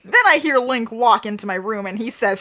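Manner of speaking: usual speaking voice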